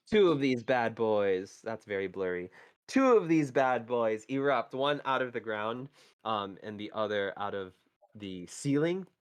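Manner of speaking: scene-setting storyteller voice; game master narration. Acted. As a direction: set the scene for the players. GM continues scene description.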